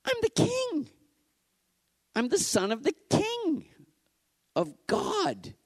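high ringing cry of proclamation; Jesus exultantly crying out his kingship; exalted cry. Acted.

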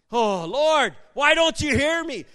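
loud, high-pitched plea; yelling at God. Acted. Believer complaining to God that he isn't heard, yelling. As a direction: cry out in mock frustration at being unheard. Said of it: "we're yelling at God".

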